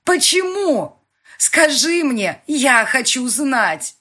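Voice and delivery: high, loud, slow demanding yell; desperate yell. A narrator's reading. Heroine demands an answer from her boss, desperate yell. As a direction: scream the demand at him.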